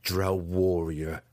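slow, scornful chiding; mock his title slowly. A narrator's reading. Weapons master slowly chiding his pupil's claimed title. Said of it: "Zack chided him".

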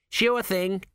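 put-on high, bright young lad; cheerful quick reply; chirpy apprentice.

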